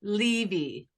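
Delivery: "Levy" with long ee, "LEE-vee"; preferred pronunciation. Shown as a demonstration. Guest answering how her name is said, "Levy" as "LEE-vee". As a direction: say "Levy" with two ee sounds. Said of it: "Is it Levy or Levy?".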